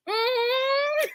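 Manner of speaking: very high, tight fake agreement; forced mm-hmm. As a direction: hum a polite lie through your teeth. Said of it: "And I'm like".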